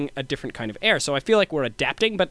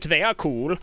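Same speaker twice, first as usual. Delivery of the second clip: ordinary talk, nothing marked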